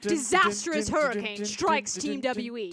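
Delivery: loud, slow dramatic breaking-news read; news anchor voice. Retold or imitated. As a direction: announce disaster like evening news. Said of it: "you sound like a news anchor".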